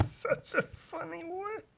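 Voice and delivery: high, soft giggly voice; giggling. Real, not acted. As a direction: laugh through the words. Laughing at the word.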